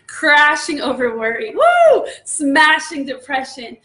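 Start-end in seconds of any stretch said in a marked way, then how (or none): 1.5-2.1 very high, loud "woo"; piercing cry of praise; exhilarated whoop. Real, not acted. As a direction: let out a soaring whoop mid-preach. Exclaiming mid-preaching about crashing waves.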